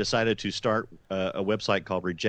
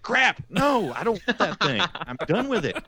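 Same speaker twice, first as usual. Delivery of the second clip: retold exclamation; 10 semitones higher, 4 dB louder, slower than usual. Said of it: "I'm like".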